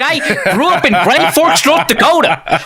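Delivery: high, loud incredulous outburst; exasperated mock retort. Answering Twitter critics who mocked him, in a loud exasperated outburst. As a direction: throw the question back, loud and high.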